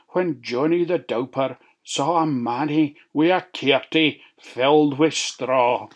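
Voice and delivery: ordinary delivery, nothing marked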